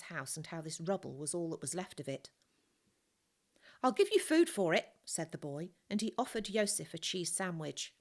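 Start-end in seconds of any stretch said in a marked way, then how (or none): 3.8-5.0 put-on a boy's voice, much higher and louder; an eager street kid striking a deal; boy bargaining. A narrator's reading. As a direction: haggle like a sly little trader.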